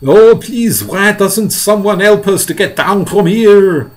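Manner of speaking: high, loud pleading call. Acted. Miss Spider begging to get down, high calling. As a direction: plead loudly for help. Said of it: "Miss Spider called out".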